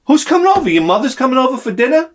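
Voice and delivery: high, bright fake-innocent surprise; mock surprise. Husband asks wife in a high mock-innocent voice. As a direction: play dumb in a high voice. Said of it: "And I said".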